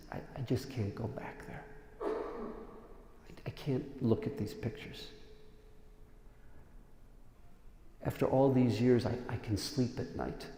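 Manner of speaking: soft, hushed, half-whispered; quiet pained refusal. Retold or imitated. Elderly survivor refusing to look at photos, hushed and pained. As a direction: speak it softly like an old survivor refusing. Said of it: "He says".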